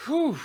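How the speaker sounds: heavy blown-out "whew"; sharp, high exhaled whew; deep breath out. Real, not acted. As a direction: let out a big breath before answering. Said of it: "let me take a deep breath".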